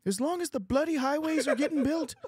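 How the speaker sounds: high-pitched, strained Canadian Kermit voice; Jordan Peterson. Acted. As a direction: argue the case like a Canadian Kermit. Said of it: "in Jordan Peterson's voice".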